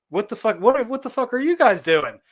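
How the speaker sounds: high, loud, incredulous outburst; retold outraged yell. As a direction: act out yelling at them in disbelief. Retold angry shock at girlfriend.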